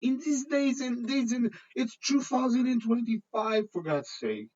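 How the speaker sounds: high-pitched, incredulous and amused; laughing. Real, not acted. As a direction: squeal it out through helpless amusement. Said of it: "I'm sorry to be laughing".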